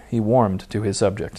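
ordinary delivery, nothing marked

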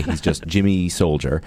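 ordinary talk, nothing marked

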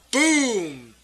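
loud, explosive boom; dramatic storyteller's boom; booming sound effect. Acted. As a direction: burst out on the crash. Game master voices a collapse.

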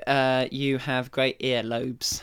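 sincere. Real, not acted. Slow earnest compliment.

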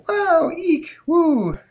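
squeaky high amazed gasps; high falsetto wows from the crowd; amused crowd. Acted. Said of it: "says the, uh, apparently easily amused nineteen forties crowd".

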